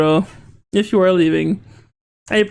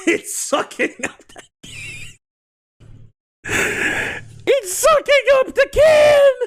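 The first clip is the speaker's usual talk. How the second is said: high squealing shock. Real, not acted. Streamer squeals at a thing sucking up the can, startled.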